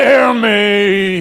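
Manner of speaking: loud ghostly wail. A narrator's reading. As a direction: howl it at Scrooge. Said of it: "he wailed".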